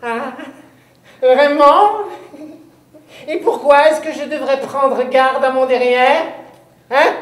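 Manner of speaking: high, loud, sour little witch voice; a nasty pointed witch voice; witch's shrill voice. Acted. Witch challenges the boy from the closet, high and sour. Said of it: "une petite voix pointue, aigre, méchante".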